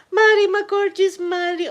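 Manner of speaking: high, loud, gushing hello; light up as a favourite viewer appears; squealed welcome to a dear viewer; delighted greeting. Real, not acted.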